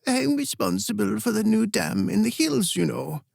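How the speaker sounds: put-on a frail old queen chirping about her pride; bright, cheery old queen. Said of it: "Queen Eleka's voice cut through ... bright and cheery".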